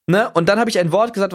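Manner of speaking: normal talking voice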